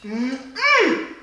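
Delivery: loud, high, joyful call to her followers; excited shout-out. Real, not acted. Calling out to her viewers.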